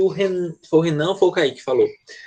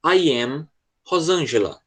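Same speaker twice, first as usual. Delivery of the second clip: slow, clear teacher read of I am Rosângela; slow model line; slower than usual. Shown as a demonstration. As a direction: read it slowly for the class to repeat. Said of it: "Eu vou falar a frase". (leitura lenta e clara para repetir)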